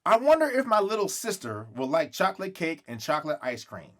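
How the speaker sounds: usual speaking voice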